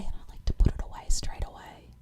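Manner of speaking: hushed confiding whisper. Real, not acted. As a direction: whisper it like a secret. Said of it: "I have to whisper it".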